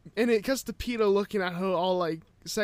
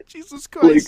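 loud, higher-pitched, through real laughter; laughing exclamation; 10 semitones higher, 8 dB louder, slower than usual. Real, not acted. Reacting to friend's joke about coming out, laughing. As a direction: burst out laughing as you say it.

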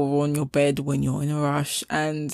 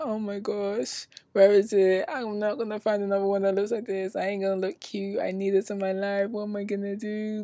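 mock heartbroken; 4 semitones higher than usual. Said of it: "so upset and so, like, heartbroken".

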